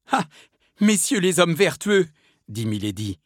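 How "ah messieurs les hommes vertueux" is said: high, loud mocking address; acted bitter taunt; scornful outcry. A narrator's reading. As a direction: throw it at them with raised-voice scorn. Condemned Milady taunting her executioners.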